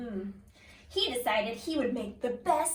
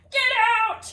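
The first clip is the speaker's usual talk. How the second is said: loud, higher angry shout; shouting chef; 8 semitones higher, 10 dB louder than usual. Acted. The chef throwing George out, loud angry shout. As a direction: bark him out of the kitchen. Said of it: "he shouted".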